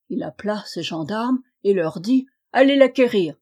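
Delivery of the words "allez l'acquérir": loud, higher command; barked order. Acted. King orders his gendarmes to fetch her, loud. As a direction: order the guards off sharply.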